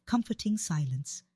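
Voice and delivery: usual speaking voice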